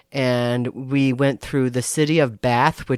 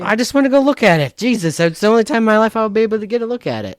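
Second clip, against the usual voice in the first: higher, louder, fast indignant protest; fast, high complaint against being stopped; 8 semitones higher, 3 dB louder, faster than usual. Retold or imitated. Protesting the British dude's yelling.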